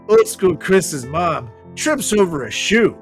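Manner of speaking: ordinary talk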